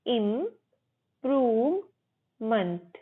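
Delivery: 'इम्प्रूवमेंट' slowly as im, proov, munt; teacher drawing out each part of 'इम्प्रूवमेंट'; syllable by syllable. Shown as a demonstration. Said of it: "इम्प्रूवमेंट नहीं, मंट".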